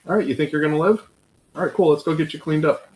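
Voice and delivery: put-on breezy, no-big-deal check on a kid; easygoing dad check-in. Dad casually checking on crashed kid.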